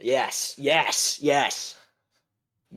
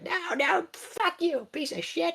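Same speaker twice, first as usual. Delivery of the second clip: high squeaky mock princess; high-pitched spoiled brat insult; bratty princess cursing; 6 dB quieter, slower than usual. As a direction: spit it out in a tiny whiny voice. Princess insults her dad.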